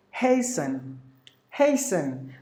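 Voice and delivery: "hasten" as HAY-sn, no T; falling, careful "hasten" without any T sound; silent T. Shown as a demonstration. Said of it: "the letter T is silent".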